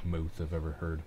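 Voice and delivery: ordinary talk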